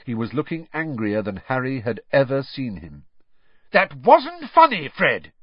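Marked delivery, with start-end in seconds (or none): 3.7-5.3 high, loud scolding shout; furious raised voice; angry shout. A narrator's reading. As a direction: yell it at a misbehaving son. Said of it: "he shouted".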